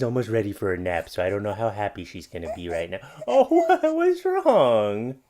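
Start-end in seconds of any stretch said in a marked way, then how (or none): 3.3-5.2 surprised coo. Real, not acted. Dad startled by his crying baby, high laughing coo.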